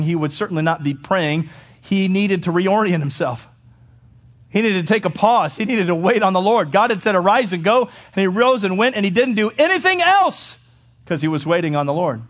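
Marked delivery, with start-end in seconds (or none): 1.8-10.6 raised pitch, building to a high peak; emphatic pulpit build; impassioned preaching. Acted. Preaches at full force.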